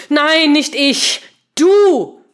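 loud, high, furious outburst; angry shout, each word punched out. A narrator's reading. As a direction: fire it back slowly, landing hard on "Du". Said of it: "hämmerte sie wütend".

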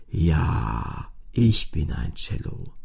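deutlich tiefer, erleichtert aufatmend; tiefe Cellostimme. A narrator's reading. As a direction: das Cello atmet endlich wieder. (tiefe, erleichterte Stimme des Cellos)

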